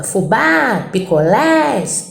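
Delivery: "Fubá" as fu-BÁ, "picolés" as pico-LÉS, endings drawn out; stressed final syllables. Shown as a demonstration. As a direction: slowly stretch the last syllable of "Fubá" and "picolés". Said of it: "A acentuação é na sílaba tônica".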